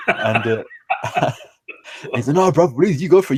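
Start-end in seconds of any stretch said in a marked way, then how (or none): 2.3-3.4 mock pleading. Brother mockingly insisting he lead.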